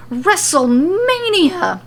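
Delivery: hammed-up arena announcer shout; loud announcement. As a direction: bellow it to the rafters. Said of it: "he announces as loud as he can".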